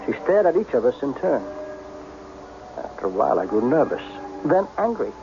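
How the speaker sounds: low, measured voice-over telling the tale; low inner narration. A narrator's reading. [name] narrating his own story. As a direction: draw the listener into his memory.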